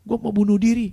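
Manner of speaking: loud, high melodramatic wail; mock crying. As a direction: wail like a heartbroken person. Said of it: "nangis depan saya". (tiruan ratapan putus asa)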